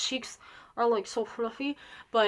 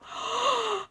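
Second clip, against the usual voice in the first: sharp high gasp; dramatic acted intake of breath; shocked gasp; 11 semitones higher, 5 dB quieter than usual. Girl told by mom she is adopted, shocked gasp.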